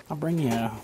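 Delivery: ordinary talk, nothing marked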